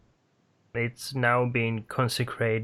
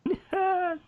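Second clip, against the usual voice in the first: shrill, unhinged cackle; high maniacal laugh. Acted. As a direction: cackle like a madman.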